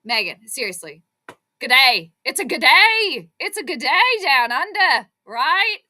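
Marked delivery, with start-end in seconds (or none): 1.6-5.8 loud, stretched-out mock Australian accent. Shown as a demonstration. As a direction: put on a hearty, exaggerated Australian accent. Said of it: "that's my best Australian accent".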